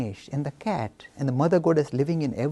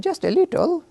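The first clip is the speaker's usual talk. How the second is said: put-on sheepish little boy; 13 semitones higher, 4 dB louder than usual. Guilty Ganesh admits hurting the cat.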